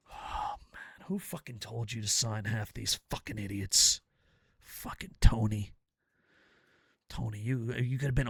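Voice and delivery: low, soft disgusted grumble; muttered. Acted. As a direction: grumble it under your breath. Muttered aside after rant.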